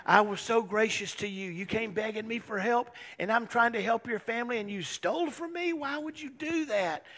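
high, loud, wounded accusation; mock outrage. Joseph accuses his brothers of stealing from him, mock outraged.

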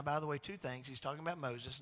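ordinary talk, nothing marked

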